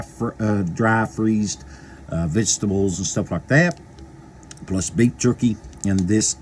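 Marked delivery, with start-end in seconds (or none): none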